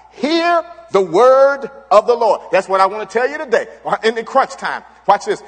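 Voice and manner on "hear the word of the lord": slow, higher, louder proclaiming; prophet's proclamation. Retold or imitated. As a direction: announce it like a prophet. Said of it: "Elijah replied".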